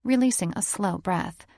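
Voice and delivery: ordinary delivery, nothing marked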